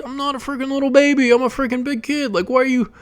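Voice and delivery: put-on high-pitched little-kid protest; embarrassed kid. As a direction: imitate a mortified child complaining to grandma. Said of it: "I would be so embarrassed".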